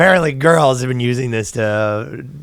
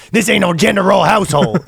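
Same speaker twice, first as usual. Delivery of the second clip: higher, hammed-up folksy command; gunslinger dad; 7 semitones higher than usual. Dad scolding son over gender roles, hammed-up gunslinger. Gun-toting father, acted.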